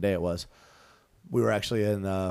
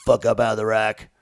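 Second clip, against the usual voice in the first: drill sergeant yell; 8 dB louder than usual. Retold or imitated. Drill instructor waking recruits.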